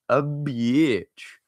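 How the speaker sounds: "bitch" leaned on with relish, savoring the bad word; emphasis on bitch. Shown as a demonstration. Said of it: "Is that how he did it?".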